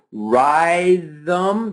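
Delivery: "rhythm" said wrongly as "RYE-thum", drawn out; mispronounced rhythm. Shown as a demonstration. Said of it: "So we don't sit here saying".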